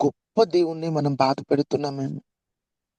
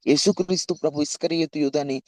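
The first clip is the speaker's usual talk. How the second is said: ordinary talk, nothing marked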